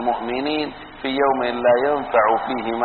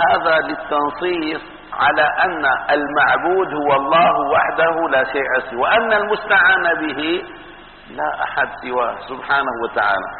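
usual speaking voice

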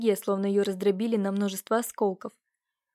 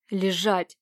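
hissed command; 3 semitones lower than usual. A narrator's reading. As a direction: hiss the order low and tight. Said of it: "прошипел человек в маске".

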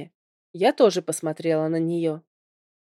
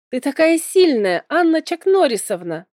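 high, loud burst of admiration; admiring exclamation; 8 semitones higher, 6 dB louder than usual. A narrator's reading. Little Arli impressed by her fighting skills, admiring exclamation. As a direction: cheer her strength in a raised, excited voice. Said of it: "вторил ему Арли".

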